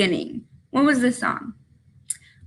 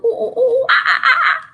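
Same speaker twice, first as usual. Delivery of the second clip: monkey calls; 12 semitones higher than usual. Shown as a demonstration. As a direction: hoot like a playful monkey for the kids. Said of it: "Do they go".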